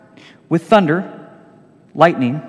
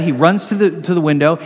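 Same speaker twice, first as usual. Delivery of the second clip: ordinary delivery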